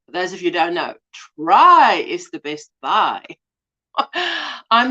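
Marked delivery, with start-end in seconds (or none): 1.4-3.2 "try" drawn out long and punched up; slow line with a big, stretched "try"; stress on try. Shown as a demonstration. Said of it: "Emphasis on the word try".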